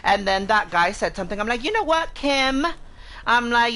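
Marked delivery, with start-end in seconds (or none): 1.6-2.7 high-pitched, challenging call-out; retold confrontational call-out. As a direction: raise the pitch and single out the opponent by name. Retells calling out the guy.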